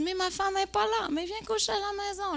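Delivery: high, light put-on man's voice; mock coaxing host. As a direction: offer the invitation in a high, easy voice. Imite l'hôte qui invite. (hôte insistant, voix haute et légère)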